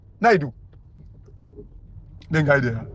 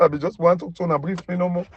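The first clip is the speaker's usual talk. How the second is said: ordinary talk, nothing marked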